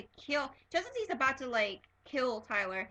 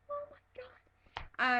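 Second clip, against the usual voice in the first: very high, slow, drawn-out gasp of words; stunned oh my god; 14 semitones higher, much slower than usual. Retold or imitated. Fan reacting to Klaus's death, stunned and slow. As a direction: let it out in awe. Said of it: "And I was like".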